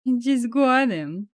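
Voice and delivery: slow, whiny mimicry; mock his whine back at him; mocking echo. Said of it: "mocking echo of I just got him".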